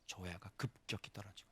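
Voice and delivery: hushed, breathy whisper. Real, not acted. Preacher whispering about views dropping. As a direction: whisper it as a confession.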